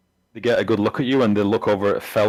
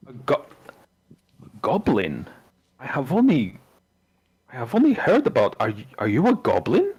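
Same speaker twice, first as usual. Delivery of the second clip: halting, stammered disbelief with pitch swoops; astonished villager; 3 semitones higher than usual. Acted. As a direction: villager marvelling at a goblin. Game master voices astonished villager.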